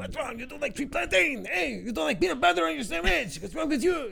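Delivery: high, loud mocking boss voice; yelling French boss. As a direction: squeal it like the pumped-up boss yelling at the crew. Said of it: "he's always yelling at everybody".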